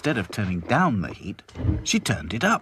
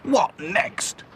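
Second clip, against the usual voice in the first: exasperated Grimes; 11 semitones higher than usual. A narrator's reading. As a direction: throw up his hands. Grimes voiced.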